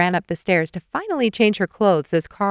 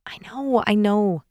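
sighing; 2 semitones higher, slower than usual. A narrator's reading. Nicole concedes to Carl, sighing. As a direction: concede it with a mild sigh. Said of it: "she sighed".

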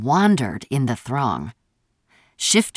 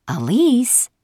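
tentative; 9 semitones higher than usual. A narrator's reading. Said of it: "Maeve eyed the sky tentatively".

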